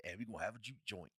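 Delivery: soft, fast sly invitation; a hint of a smooth-talking vampire; mock villain. Vampires inviting the town, lightly mocked.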